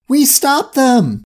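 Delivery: high, drawn-out triumphant cheer; celebrating. A narrator's reading. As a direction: cheer the victory slowly and high. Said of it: "and Vicky celebrated".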